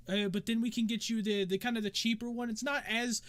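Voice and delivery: high, chatty hostess; chatty tavern dancer. Acted. Game master plays the dancer.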